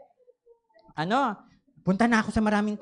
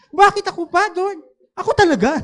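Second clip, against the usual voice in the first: loud, high whining protest; louder, higher "bakit ako pa?"; mock complaint; 10 semitones higher, 8 dB louder than usual. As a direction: complain to God with comic outrage. Repeating complaint to God.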